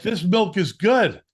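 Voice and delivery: high, loud, slow yell at the customers; retold shout. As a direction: shout it like a fed-up kid, slowly. Kid arguing with customers.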